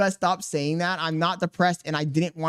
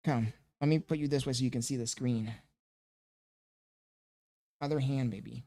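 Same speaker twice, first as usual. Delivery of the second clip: much softer, low and gentle; soft murmur; 4 semitones lower, 9 dB quieter than usual. Real, not acted. Dad quietly settling his baby in front of the screen, murmured.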